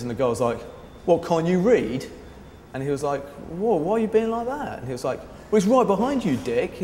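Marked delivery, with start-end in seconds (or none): 1.1-2.1 rude. Retold or imitated. Rude guy snaps at friend. 3.5-4.8 taken aback. Retold or imitated. 5.5-6.8 sneering retort, higher and louder; insulting retort. Retold or imitated. Rude guy insults friend.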